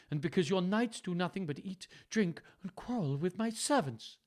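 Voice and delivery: lightly voiced cold daughter; stern Goneril. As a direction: scold the old father. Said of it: "said Goneril".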